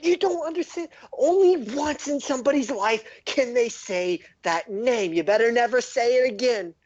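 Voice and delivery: very high, incredulous gnome voice; scolding gnome, exasperated. Acted. Surface gnome warns an outsider never to say the name, high and exasperated. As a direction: warn them off in a squeaky, exasperated pitch.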